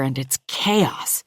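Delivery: usual speaking voice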